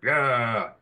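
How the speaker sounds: low drawn-out "yeah". Shown as a demonstration. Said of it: "now make it lower".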